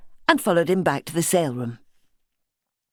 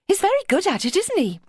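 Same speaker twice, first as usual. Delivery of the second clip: a much higher light American woman's voice; Beverly's voice. Acted. Beverly teasing Simmy about Chris, pitched high.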